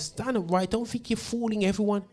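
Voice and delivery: normal talking voice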